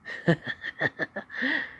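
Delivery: high breathy sinister laugh; evil giggle. Acted. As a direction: cackle under your breath. Izuku laughs menacingly.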